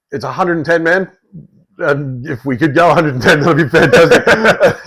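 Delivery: exasperated plea. Retold or imitated. Retold exasperated plea to driver.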